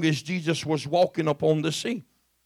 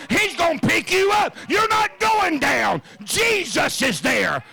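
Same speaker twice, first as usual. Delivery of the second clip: much higher, shouted preaching; full-force preaching; 9 semitones higher than usual. Acted. Preacher crying out to his congregation, shouting. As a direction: bring the church to its feet.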